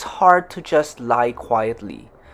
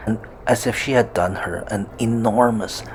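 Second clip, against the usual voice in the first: usual speaking voice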